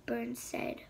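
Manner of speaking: ordinary delivery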